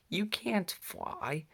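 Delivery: high, slow disbelief; a mother bear's gentle doubt; incredulous mother. Acted. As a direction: stretch it out, amazed he'd even try. Mother Bear doubts flying cub.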